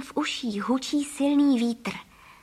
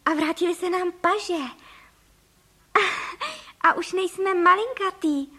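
high, delighted exclamation; laughing delight; 6 semitones higher than usual. A narrator's reading. Wife rejoicing at having arms back, delighted. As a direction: marvel happily, pitch lifted high. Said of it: "zasmála se paní Frejková".

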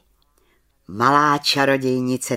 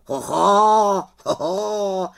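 raised raven cry, long vowels; astonished exclamation; 8 semitones higher, 6 dB louder, much slower than usual. A narrator's reading. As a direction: exclaim in amazement at the sight. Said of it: "zvolal Abraxas". (udivené zvolání havrana)

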